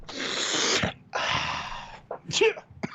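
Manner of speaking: long gasping wind-up to a sneeze; acted sneeze build-up. Co-host acts Wesley walking in sneezing, exaggerated breathy sneeze. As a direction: imitate a huge stifled sneeze, drawn out. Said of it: "he's just like".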